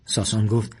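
ordinary delivery, nothing marked

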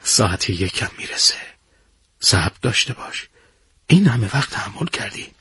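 calm a restless comrade in a quiet voice; softly patient; calm and soothing. A narrator's reading. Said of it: "با لحن آرومی جواب داد".

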